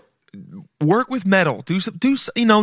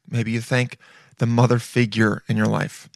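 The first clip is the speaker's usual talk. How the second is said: ordinary talk, nothing marked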